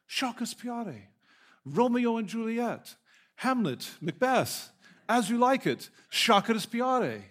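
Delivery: high-pitched mock Italian accent, insistent; Italian-sounding accent. Student listing plays to a baffled librarian, imitated Italian accent. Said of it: "That sounds Italian".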